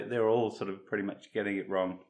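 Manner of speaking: normal talking voice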